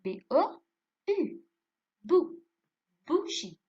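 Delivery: loud, high letters and syllable, each set apart; emphatic classroom sounding-out, piece by piece; syllable drill. Shown as a demonstration. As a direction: project each piece for pupils to copy. Teacher builds word from syllable.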